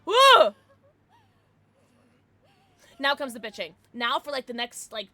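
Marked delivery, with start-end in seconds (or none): 0.1-0.6 excited cheer. Real, not acted. Cheering during play.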